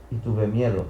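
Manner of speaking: usual speaking voice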